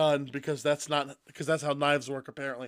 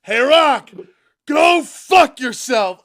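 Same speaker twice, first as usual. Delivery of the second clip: loud, higher yell, slow on go fuck yourself; mock angry shout; 7 semitones higher, 11 dB louder, slower than usual. Cody yelling at the Rock, loud and defiant. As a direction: bellow it at him, defiant. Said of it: "he li".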